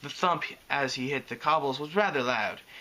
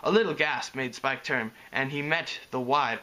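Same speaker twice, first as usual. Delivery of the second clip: ordinary delivery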